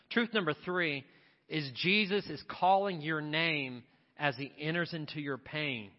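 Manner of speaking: normal talking voice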